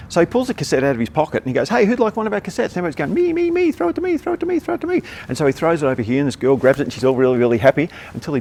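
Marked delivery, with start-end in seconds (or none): 1.7-2.7 high, bright call to the crowd; lightly voiced Mike hamming it up. Mike offering cassette to crowd. 3.1-5.0 very high, excited pleading chant; high-pitched crowd clamour; crowd begging. Retold or imitated.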